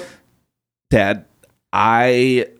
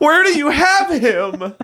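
laughing while demanding. Acted. Repeated, laughing through it.

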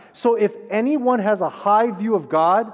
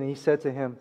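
ordinary talk, nothing marked

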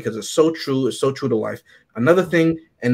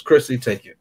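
normal talking voice

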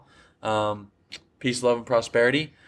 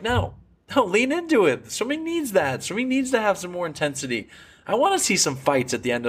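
excited insistence; 7 semitones higher than usual. Real, not acted. Insisting swimming needs rivalry.

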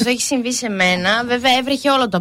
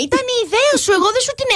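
mock innocent guy; 10 semitones higher, much faster than usual. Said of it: "Α, μα αυτό είναι".

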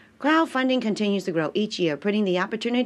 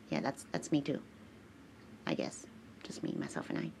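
muttered aside; 4 semitones lower, 15 dB quieter, faster than usual. Real, not acted. Quiet aside to herself.

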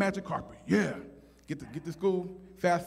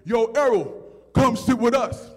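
loud call across a cafeteria; imitated big kid hollering over; 5 semitones higher, 7 dB louder, slower than usual. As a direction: invite a friend over, louder and higher. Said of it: "All of a sudden I hear".

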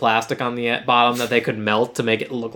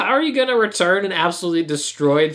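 much higher sassy mock voice, calling her out; 9 semitones higher than usual. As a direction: tease her like a gossiping friend, pitched way up. Mock-scolding the character in play.

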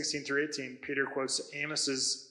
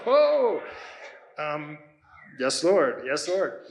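startled yes; 8 dB louder, much slower than usual. Retold or imitated. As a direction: caught off guard, hurrying to say yes, Lord. Startled reply to the Lord.

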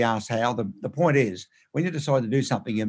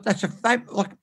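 higher-pitched excited discovery; retold excitement; 7 semitones higher than usual. Calling his partner to look at the television, retold excitement. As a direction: eagerly point something out to your partner.

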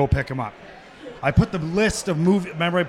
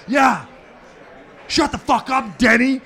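louder, higher shut the fuck up; mock yell over the top; 6 semitones higher, 4 dB louder, slower than usual. Backing up a teammate with a mock yell. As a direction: pile on with a shout. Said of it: "you come over the top".

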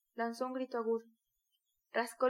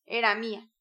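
louder, bitter bark; angry shout; 2 semitones lower, 7 dB louder than usual. A narrator's reading. Micolca standing over the dead mare, bitter shout. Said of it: "gritó Micolca".

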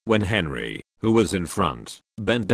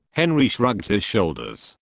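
ordinary delivery, nothing marked

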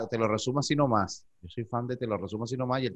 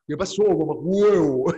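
lightly imitated Venezuelan slang, slow; mock Venezuelan accent; 3 semitones higher, 5 dB louder, slower than usual. Mexican coworkers mocking her Venezuelan accent, slow and teasing. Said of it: "como me imitaban".

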